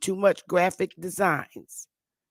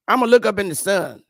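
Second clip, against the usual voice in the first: louder repeat, "look" punched hard; mock defiant boast; 4 semitones higher, 6 dB louder than usual. Mocking stoners to his viewers, loud defiant repeat. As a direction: belt out "I'ma look up in the sun" like a dare.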